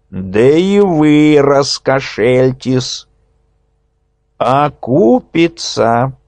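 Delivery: slow, sly, scheming voice; sly schemer. A narrator's reading. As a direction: spell out the plot slyly. Nezda announcing.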